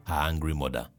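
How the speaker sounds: plain reading voice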